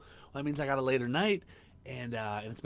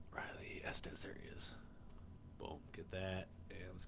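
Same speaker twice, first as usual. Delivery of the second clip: whispered names while tagging; 3 semitones lower, 15 dB quieter than usual. Real, not acted. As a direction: whisper the names as you type them. Tagging names under his breath.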